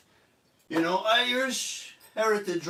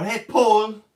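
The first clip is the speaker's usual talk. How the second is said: mock call; 3 semitones higher, 7 dB louder than usual.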